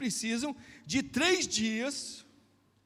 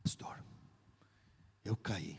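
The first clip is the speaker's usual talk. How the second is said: hushed confession; 13 semitones lower, 9 dB quieter, slower than usual. Retold or imitated. Young man confessing by phone.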